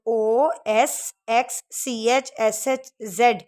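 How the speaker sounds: slow letter by letter spelling; spelled slowly. Shown as a demonstration. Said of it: "rules के according". (धीरे अक्षर-अक्षर)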